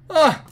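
sudden high, loud yelp of alarm; real startled shout; startled scream. Real, not acted. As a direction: shriek as the enemies hit. Ambushed by enemies.

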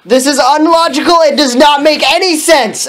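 high, loud indignant bellow. A narrator's reading. Narrator voices Ebony protesting the polos, bellowed. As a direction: bellow it out in outrage. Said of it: "Ebony bellowed".

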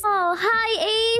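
ordinary talk

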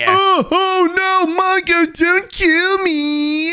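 high, whiny begging; fearful pleading. Acted. Wudo begging Mongo for life, high pleading. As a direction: beg for your life, drawing out the end.

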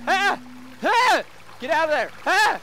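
very high, loud repeated yells; short explosive yells with a shouted order; yelling. Pretend. Player yelling to free trapped souls, explosive yelling. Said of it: "every time I yell at one".

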